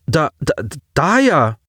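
stammered, high-pitched startled name; flustered boy, voice jumping up; nervous surprise. A narrator's reading. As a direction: stumble over the name in surprise. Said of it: "blinzelte nervös".

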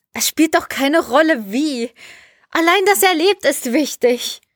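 high-pitched, insistent protest. A narrator's reading. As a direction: insist that nothing else matters. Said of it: "beharrte Jelena".

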